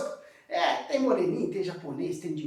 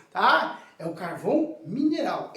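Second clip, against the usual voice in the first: ordinary talk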